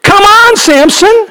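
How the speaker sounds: preaching at full force. Acted. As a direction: plead with Samson from the pulpit. Pleading with Samson.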